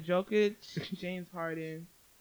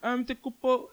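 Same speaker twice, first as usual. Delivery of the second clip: put-on Greek accent; 5 semitones higher, 4 dB louder than usual. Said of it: "let me do my terrible Greek accent".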